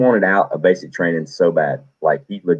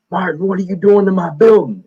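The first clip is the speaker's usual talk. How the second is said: much higher, barking drill sergeant voice; angry drill sergeant; 11 semitones higher, 3 dB louder than usual. Retold or imitated. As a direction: bark at the recruit like a furious drill instructor. Drill sergeant scolding recruit.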